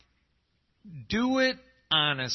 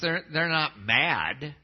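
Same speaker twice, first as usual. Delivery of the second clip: ordinary delivery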